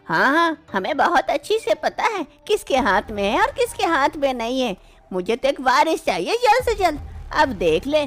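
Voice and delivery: put-on the mother-in-law taunting her daughter-in-law about a baby.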